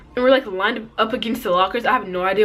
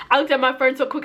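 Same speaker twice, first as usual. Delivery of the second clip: usual speaking voice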